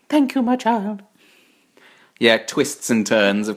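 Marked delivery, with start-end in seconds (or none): 0.1-1.0 higher, motherly character voice; hammed-up Mother Nature voice. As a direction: bless them like Mother Nature.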